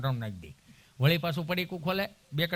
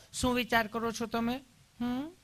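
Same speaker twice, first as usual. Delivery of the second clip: higher-pitched, cooing nurse voice; sweetly caring nurse; 5 semitones higher, 3 dB quieter than usual. Acted. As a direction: ask lovingly, ending on a soft little hum. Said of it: "તમે કેટલું પ્રેમથી પૂછો છો".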